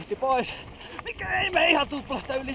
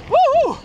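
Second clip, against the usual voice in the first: sharp high startled whoop on a rough patch; 13 semitones higher, 7 dB louder than usual. Real, not acted. Rider on a loose trail gives a startled whoop. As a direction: yelp it out as the bike slips.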